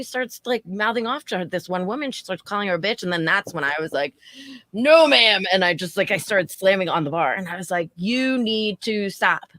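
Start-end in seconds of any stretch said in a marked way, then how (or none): 4.7-5.4 loud firm "No, ma'am" cutting her off; stern shout. Retold or imitated. Bartender shouting down a rude customer, loud and firm. 8.0-9.5 slow, deliberate scolding; like scolding a child. Retold or imitated. Scolding a drunk customer like a child, slow and firm. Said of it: "Like, like a child".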